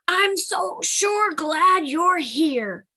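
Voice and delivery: high, loud, slow and proud; proud Grizzly Bun. Acted. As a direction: puff up like a tiny bear. Grizzly Bun relieved and glad.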